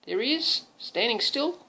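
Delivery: ordinary delivery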